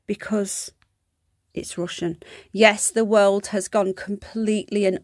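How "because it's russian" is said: conspiratorial whisper. Real, not acted. Conspiratorial aside about the mascot.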